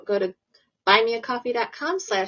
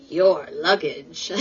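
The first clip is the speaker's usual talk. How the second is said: put-on slow, lower Schwarzenegger accent that drifts Irish; Arnold Schwarzenegger accent; 5 semitones lower, much slower than usual. Host quoting Arnold in a shaky Schwarzenegger accent, slow and lower. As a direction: drag out your luggage in a slightly Irish-sounding Arnold accent. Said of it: "I sound Irish when I try to do his accent".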